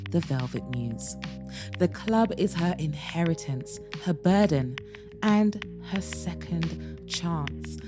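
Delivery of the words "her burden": plain reading voice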